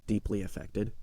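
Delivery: ordinary delivery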